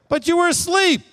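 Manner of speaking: very high-pitched mock protest; hammed-up, high-voiced complaint; panicked protest. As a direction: blurt it back as a frantic accusation. Voicing panicked apostles protesting.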